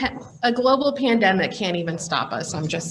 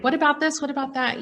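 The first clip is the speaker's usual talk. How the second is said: higher-pitched, mildly frantic mock questions; lightly acted overwhelm, pitch up; 6 semitones higher, faster than usual. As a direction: imitate yourself getting overwhelmed, voice lifted. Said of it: "when I get overwhelmed".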